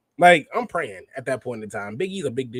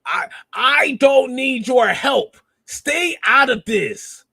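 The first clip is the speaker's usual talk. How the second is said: slow, high put-on voice with a heavy accent, loud; Io Sky's cool brush-off; 10 semitones higher, 6 dB louder, much slower than usual. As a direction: play Io Sky coldly brushing off help, drawn out. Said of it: "it's just like".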